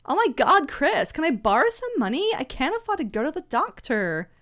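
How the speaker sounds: put-on high, swooping American Valley Girl. Asking Chris for money for the doctor, whiny Valley Girl. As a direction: gush like an exaggerated Valley Girl.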